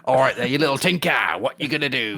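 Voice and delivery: a chirpy cockney Cenobite taunting. Pretend. Host plays a cockney Cenobite offering power, mock cockney. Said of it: "slightly cockney working class Cenobites".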